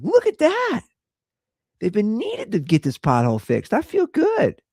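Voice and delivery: high-pitched gleeful mock excitement; excited. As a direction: squeal it, delighted over a tiny thing. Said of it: "I was all excited".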